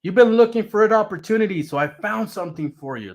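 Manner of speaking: high, bubbly mock excitement from the girlfriend; excited. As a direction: gush like she has big news. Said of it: "She's like".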